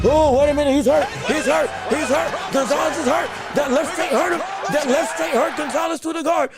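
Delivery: loud, urgent ringside call; excited shout. Real, not acted. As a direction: yell it as the punch lands. Fight commentator erupts at hurt fighter.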